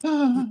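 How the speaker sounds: high, pleased sigh of delight; an ah of pure chocolate bliss; blissful ah. Shown as a demonstration. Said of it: "and just going".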